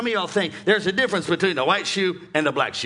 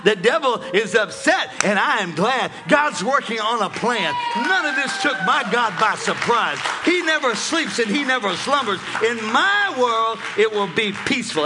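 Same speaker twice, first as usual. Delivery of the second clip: loud, high preaching shout; full-force preaching; 6 semitones higher, 7 dB louder than usual. Real, not acted.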